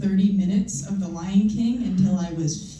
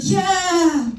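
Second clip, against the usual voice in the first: eager cheer; 10 semitones higher than usual. Retold or imitated. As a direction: cheer along with naive excitement. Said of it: "I'd be like".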